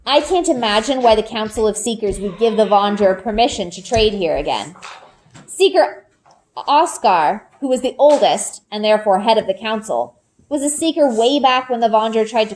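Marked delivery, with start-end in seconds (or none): none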